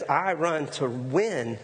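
drawn-out, every word weighted; slow down and lean on each word; slow emphatic. Retold or imitated. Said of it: "Paul says".

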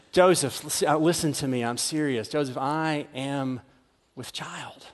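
Mary to Joseph, hushed and serious; quiet earnest plea. Acted. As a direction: plead gently, voice sinking away. Mary insists she's serious.

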